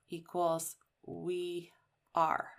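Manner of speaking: normal talking voice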